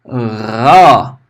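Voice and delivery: ró with air rubbing in the throat; teacher demoing a throaty fricative r. Shown as a demonstration. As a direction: let the ró rasp with breath.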